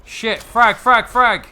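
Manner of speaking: sudden high loud yell of warning; grenade warning yell. Real, not acted. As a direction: shout the grenade warning urgently. Grenade thrown in shooter game.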